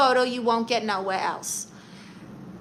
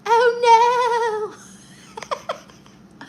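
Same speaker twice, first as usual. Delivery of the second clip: very high cartoon "oh no"; 13 semitones higher, 9 dB louder than usual. Streamer repeating a funny cartoon character, high mock dismay. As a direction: squeal it in a hammed-up cartoon voice, breaking into laughter.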